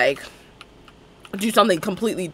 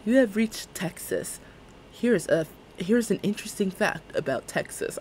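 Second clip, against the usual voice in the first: GPS voice; 7 dB quieter than usual. Retold or imitated. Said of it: "you need to be a G P S".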